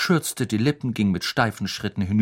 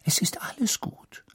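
soft, whispery reassurance; calm them down in a hushed voice; hushed and soothing; 10 semitones higher, 6 dB quieter than usual. A narrator's reading. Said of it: "sagte Madame Pomfrey besänftigend".

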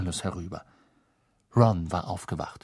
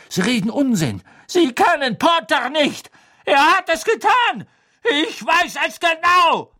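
frantic rant; 7 dB louder than usual. A narrator's reading. Snape ranting at Fudge.

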